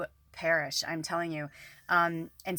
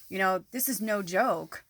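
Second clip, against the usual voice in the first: ordinary delivery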